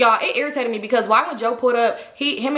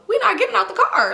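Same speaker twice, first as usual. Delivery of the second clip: high-pitched, indignant protest; stubborn refusal; 10 semitones higher than usual. Retold or imitated. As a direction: refuse in a raised, put-out pitch. Acted refusal in car quarrel.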